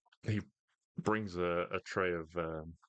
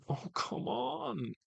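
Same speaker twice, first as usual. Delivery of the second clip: high, drawn-out exasperated groan; pleading, exasperated whine at a failed spell; much slower than usual. Acted. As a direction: stretch it out in acted frustration. Waiter's healing spell fails.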